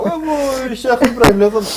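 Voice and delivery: high, excited cry of admiration; admiring exclamation. Real, not acted. Host marvelling at the sheikh, excited and admiring.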